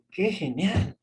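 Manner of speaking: lifted, surprised exclamation; mock amazement. Shown as a demonstration. Teacher showing how a surprised person exclaims, amazed. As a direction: act out being amazed. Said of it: "palabras en las cuales dice".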